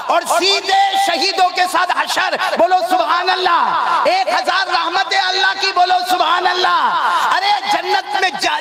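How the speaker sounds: high, drawn-out rallying cries; preaching at full force. Real, not acted. Preacher calls crowd to answer Subhanallah, full-force exhortation. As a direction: rouse the congregation, stretching key words. Rousing the congregation to respond.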